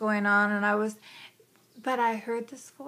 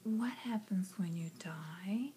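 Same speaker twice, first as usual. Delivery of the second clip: unvoiced whispered question, softer; child's whisper; 3 semitones lower, 12 dB quieter than usual. Small daughter asks father about death, whispering. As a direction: whisper it shyly like a small girl.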